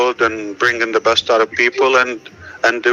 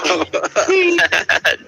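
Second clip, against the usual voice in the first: a burst of high-pitched laughter. Real, not acted. Co-host laughing at his own on-air slip, high laugh.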